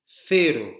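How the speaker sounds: Cero with a loud th at the start, like thero; tongue out between the teeth for Cero; Spanish model word. Shown as a demonstration. Teacher modelling Cero loudly, lisped th like English. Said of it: "c'est le moment où la langue sort de la bouche".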